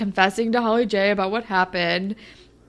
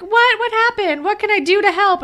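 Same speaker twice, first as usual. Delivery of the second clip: high, loud, fake concern; over-eager mock sympathy; nosy Jenna; 13 semitones higher, 5 dB louder than usual. Jenna pouncing on Sav's breakup, high and loud. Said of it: "she's like".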